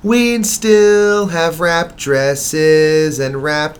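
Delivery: slow, dramatic recitation; poem reading. Acted. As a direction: read each line like verse. Reads poem she wrote.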